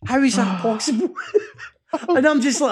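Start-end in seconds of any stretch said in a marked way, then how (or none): 0.1-1.1 incredulous, half laughing at the absurdity; laughing disbelief. Retold or imitated. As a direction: ask it again through a laugh, higher. Repeats disbelief about son's luck.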